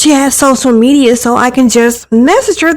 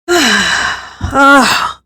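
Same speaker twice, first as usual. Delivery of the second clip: annoyed sigh; 3 semitones higher than usual. Acted. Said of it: "Jasmine sighs and pinches".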